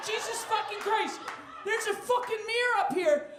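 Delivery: high, loud laughing exclamation; laughing shout. Acted. Comic railing at a mirrored set. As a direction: blurt it out, half laughing. Mock theatrical outrage.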